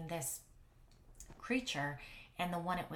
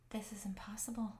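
hushed, slightly higher whisper; 4 semitones higher, 3 dB quieter than usual. A narrator's reading. Narrator whispers on seeing the alien behind her, whispered. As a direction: whisper it in disbelief. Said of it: "I whispered".

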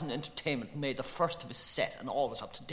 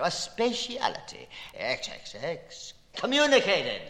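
mock radio advert; 3 semitones higher, 5 dB louder than usual. Mock butcher advertisement in narration.

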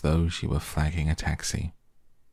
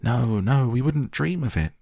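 cried out; 7 semitones higher than usual. Acted. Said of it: "the others all cried".

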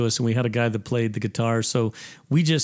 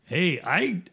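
higher, mock self-introduction; Greg Wrubell; 4 semitones higher, slower than usual. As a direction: imitate a sports announcer introducing himself to kids. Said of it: "I remember Greg going".

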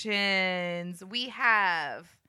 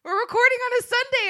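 much higher, louder and fast; excited announcement; 12 semitones higher, 7 dB louder, much faster than usual. Acted. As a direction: rush it out in mock alarm. Mock announcement.

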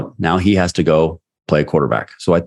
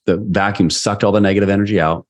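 ordinary delivery

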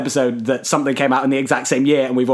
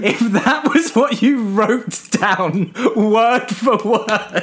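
slow, higher, drawn-out sceptical delivery; pointed sarcasm; 5 semitones higher, much slower than usual. Real, not acted. Co-host doubting the apology letter, slow pointed scepticism. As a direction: stretch it out pointedly, word by word.

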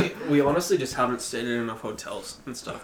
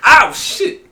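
high, loud cry of pain; pained yelp; 14 semitones higher, 13 dB louder than usual. Real, not acted. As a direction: yelp from a sudden smack. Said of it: "That hurt".

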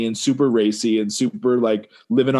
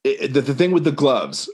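usual speaking voice

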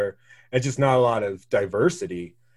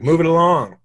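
louder, higher imitation of hurried locals; mock impatient order; 5 semitones higher, 7 dB louder than usual. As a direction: call it out briskly, as impatient people would. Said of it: "They're like".

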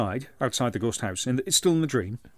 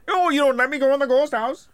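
high whining mimic; mock the kid's whine; high mocking whiny kid; whiny child; 11 semitones higher, 3 dB louder than usual.

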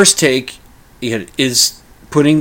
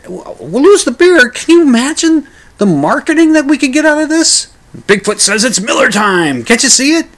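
excited high voice; 12 semitones higher than usual. Retold or imitated. Roger voiced, selling the idea.

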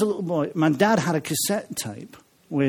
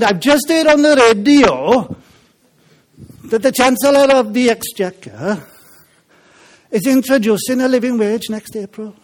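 put-on Welsh accent; 4 semitones higher, 5 dB louder than usual. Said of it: "this Welsh accent".

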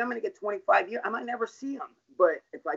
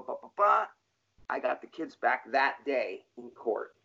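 ordinary talk, nothing marked

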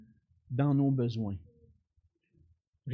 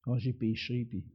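mumbled excuses; 5 semitones lower, 5 dB quieter than usual. Retold or imitated. Voicing excuses of believers.

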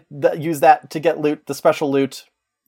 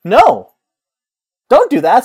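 loud, high mock protest of the crowd; mock crowd outcry; 7 semitones higher, 6 dB louder, slower than usual. Players begging Sylvanas not to turn evil, loud mock outcry. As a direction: cry out like a dismayed crowd. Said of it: "And everyone's like".

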